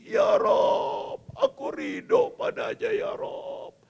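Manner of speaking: high, slow, cracking cry to God; tearful prayer. Acted. Mother overwhelmed, praying in tears. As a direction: cry it out to the Lord.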